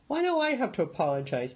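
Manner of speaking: high, indignant protest. A narrator's reading. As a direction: push back, offended. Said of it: "his eyes still blazing".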